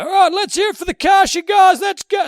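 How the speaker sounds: loud high MC shout-out; rev up guy. Retold or imitated. Imitating the hype man praising the cleaners, MC shout. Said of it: "and he's a guy".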